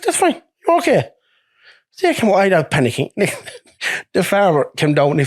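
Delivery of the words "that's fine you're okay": high, lightly mocking soothing voice; imitating the calm lorry owner on the phone; mock soothing boss. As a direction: imitate the boss calming him down, pitched way up. Voicing lorry owner calming him.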